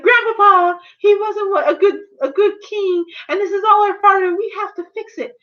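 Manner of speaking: high mock tearful plea. Anna's tearful confession.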